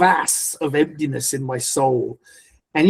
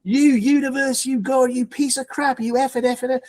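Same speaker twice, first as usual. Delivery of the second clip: retold furious rant; 7 semitones higher than usual. Man kneeling on the bed railing at God, retold furious rant. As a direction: re-enact cursing heaven in a strained high pitch. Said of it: "I'm screaming at the wall".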